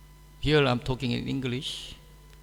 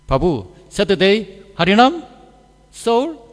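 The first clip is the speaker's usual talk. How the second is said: louder and higher, bare nouns rising; clipped loud questioning; 6 semitones higher, 10 dB louder than usual. Retold or imitated. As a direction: bark out just the nouns, rising each time. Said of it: "you know how they ask?".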